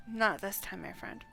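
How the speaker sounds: ordinary talk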